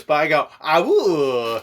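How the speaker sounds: loud hooting woo; bouncy playful with animal noises. Retold or imitated. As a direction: whoop it out, playful. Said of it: "And he goes".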